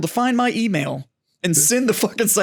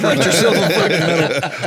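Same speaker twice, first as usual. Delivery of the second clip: laughing; 4 dB louder, slower than usual. Real, not acted. Joking about self-awarded medals.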